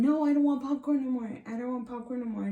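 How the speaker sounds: quick mock protest to comfort mom; urgent pleading. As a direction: rush it out like an anxious kid. Child pleading with crying mother.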